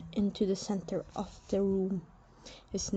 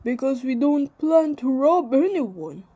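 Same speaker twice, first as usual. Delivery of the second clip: smooth reassurance; 5 semitones higher, 7 dB louder than usual. Acted. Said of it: "Antef said smoothly".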